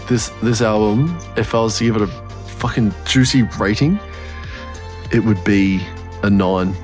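normal talking voice

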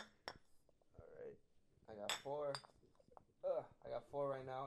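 muffled, mouth at the bottle; while sipping a drink. Real, not acted. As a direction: talk between sips of beer. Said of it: "Put the mic down".

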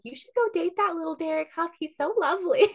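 high, gushing grandma voice. Retold or imitated. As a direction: gush like a doting grandmother. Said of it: "now she keeps being like".

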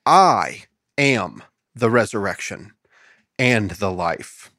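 slow reverent emphasis on each word; slow emphatic repeat. Shown as a demonstration. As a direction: let it land slowly and solemnly. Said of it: "I want to repeat that".

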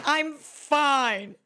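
high, loud, drawn-out insistence; overdone "fine". Acted. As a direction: blurt it high and loud, hammed up. Mock reply to how are you.

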